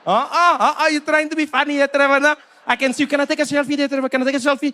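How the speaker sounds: high-pitched South African fan pestering for a favour; chide, then beg excitedly; high, pushy local-guy voice; eager street fan. Acted.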